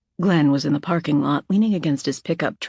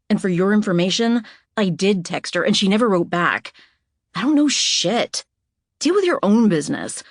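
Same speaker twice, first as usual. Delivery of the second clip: louder and higher, biting; angry retort; 4 semitones higher, 3 dB louder than usual. Acted. Anna snapping at Glenn to mind his own business, angry. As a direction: put him in his place.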